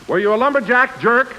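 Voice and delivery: usual speaking voice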